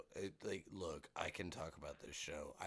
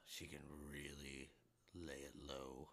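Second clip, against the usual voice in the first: gravelly voice; 3 semitones lower, 10 dB quieter than usual. Shown as a demonstration. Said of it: "really good gravelly voice".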